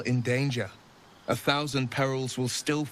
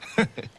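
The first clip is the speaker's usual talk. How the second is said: short laugh; 8 semitones higher than usual. Acted. Laughs at dance request.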